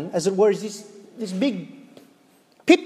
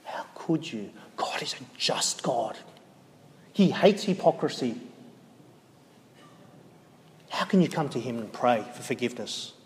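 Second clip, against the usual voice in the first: Satan's accusing whisper; 7 semitones lower, 8 dB quieter than usual. Acted. Preacher voicing Satan whispering accusation after sin, low and soft. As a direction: hiss the guilt into their ear. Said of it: "what does he start whispering in your ear".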